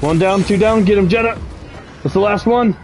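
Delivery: excited high callouts; combat callout. Real, not acted.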